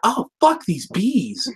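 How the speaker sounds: a gentle pony swearing under her breath; mock Fluttershy. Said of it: "I just totally imagine her".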